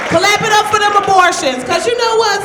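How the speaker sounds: loud, high call to the crowd; rally the room to clap; shouted call. Real, not acted. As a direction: hype shout at the audience. Calling out to crowd.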